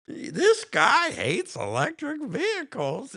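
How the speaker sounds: lightly voiced high, slow oil man; a hint of the whiny oil guy; oil guy from The Jerk. Streamer mocks a man who hates electric cars in a mild high whiny voice. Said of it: "like the oil guy from The Jerk".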